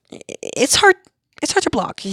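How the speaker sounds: high excited, slowed; excited squeal. Real, not acted. Laughing, high excited aside.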